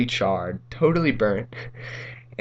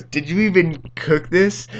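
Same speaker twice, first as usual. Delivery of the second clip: higher-pitched mock indignation; mad mom imitation; 7 semitones higher, 4 dB louder than usual. Mom questioning her son's cooking, mildly mock-angry. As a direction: lightly mimic an indignant mom's challenge. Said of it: "she was so mad".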